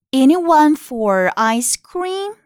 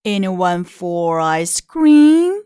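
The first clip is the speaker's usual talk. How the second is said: furry, friendly voice; 4 semitones lower, 4 dB louder, much slower than usual. Acted. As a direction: coax them sweetly like a furry wolf. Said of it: "said a furry, friendly voice".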